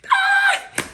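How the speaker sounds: a short, very high cry of release; frustrated shriek. Real, not acted. Vlogger shaking off her tears, short high shriek. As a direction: let out a sharp high "ah" to shake it off.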